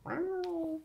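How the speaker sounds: high-pitched cat meow; imitated meow. GM voicing the cat in the tree, high mewing meow. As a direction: mew like a small cat stuck up a tree. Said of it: "You hear".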